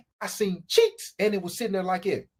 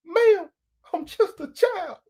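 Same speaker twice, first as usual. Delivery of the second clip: put-on higher, pleading little-boy voice; mock childlike plea; 8 semitones higher, slower than usual. Teen pleading innocence to the cougar, high mock whine. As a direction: beg like a helpless kid. Comic catchphrase refrain.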